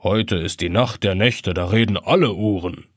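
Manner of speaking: put-on low, gentle old clock; gentle grandfather clock. A narrator's reading. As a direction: ancient clock gently teasing the boy. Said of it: "fragt die Standuhr sanft".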